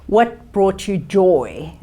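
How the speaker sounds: ordinary talk, nothing marked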